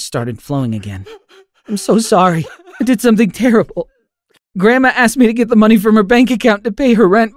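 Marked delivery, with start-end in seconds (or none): 1.7-7.3 high, loud, tearful pleading; distraught boy confessing to his parents; tearful confession. Acted. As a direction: blurt it out near tears, voice straining high. Confessing in tears.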